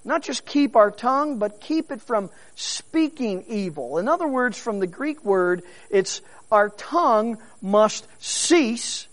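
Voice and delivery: normal talking voice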